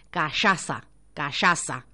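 Callaza said low and deliberate; careful pronunciation. Shown as a demonstration. Host practising the correct word callaza. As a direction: think it through: callaza. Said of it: "para decirlo bien".

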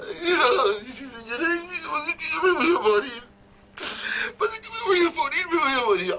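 high, hammed-up crying wail; mock wailing maid. The maid Jennifer wailing to her lady, hammed-up crying. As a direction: wail like a melodramatic maid sure she's dying.

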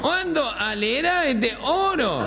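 high, drawn-out nasal mumble; gangoso. Acted. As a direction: honk it slowly with swallowed consonants. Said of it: "medio gangoso era". (voz gangosa, aguda y lenta)

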